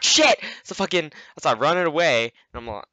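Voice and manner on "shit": high, loud startled yelp. Retold or imitated. Player spots a beast running at him, startled yelp. Retells fright before running away.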